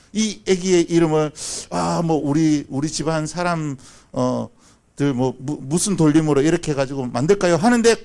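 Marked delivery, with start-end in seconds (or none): none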